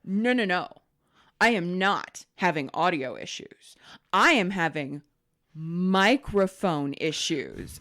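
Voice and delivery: deliberate, over-stressed words; parroting a patronizing tech, key words stretched; mock-pedantic correction. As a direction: correct him as if he were slow. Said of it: "why are you talking like that".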